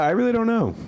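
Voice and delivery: high, flustered laugh. Real, not acted. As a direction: caught off guard, laughing. Flustered at being teased.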